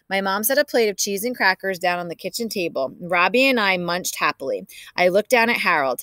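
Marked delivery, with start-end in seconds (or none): none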